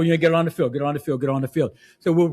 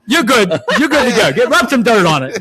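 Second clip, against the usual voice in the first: gruff coach; 4 semitones higher, 7 dB louder than usual. Retold or imitated. Mock coach dismissing injury.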